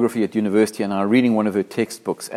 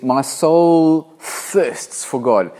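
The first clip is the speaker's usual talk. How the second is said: earnest longing; 4 semitones higher, 3 dB louder, much slower than usual. Acted. Preacher reading psalm with feeling.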